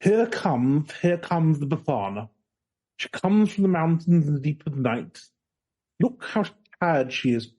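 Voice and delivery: posh English poetry reading voice; posh English accent. Pretend. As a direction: recite it like a posh English poet. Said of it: "can you do it in a posh English accent for me".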